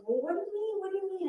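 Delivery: retold alarm, high and soft; alarmed questioning. Mother retells questioning her panicked niece.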